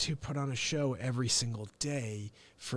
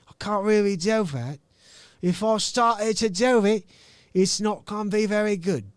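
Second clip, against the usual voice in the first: louder, higher attempt at an English accent; 5 semitones higher, 7 dB louder than usual. Shown as a demonstration. Host tests an English accent on viewers, loud and hammed-up. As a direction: try a bold English accent. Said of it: "I can't do an English accent".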